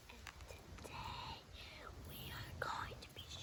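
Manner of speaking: hushed, unvoiced false start; whispering. Real, not acted. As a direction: whisper the opening line. Whispered false start before restart.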